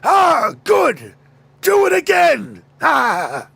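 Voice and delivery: high loud hammed-up shout of pleasure; mock Megatron. Host voicing Megatron getting smacked, hammed-up yell. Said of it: "he would probably be like".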